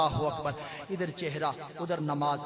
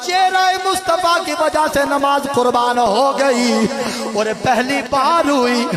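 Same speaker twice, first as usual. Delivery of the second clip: high, loud preaching at full force; a preacher carried away by the story, calling out loud; fiery preaching; 7 semitones higher, 18 dB louder, slower than usual. Acted. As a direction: preach it with rising passion. Preaching climax, story voices.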